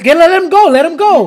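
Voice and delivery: urgent retold shout. Urgent retold command to release choke.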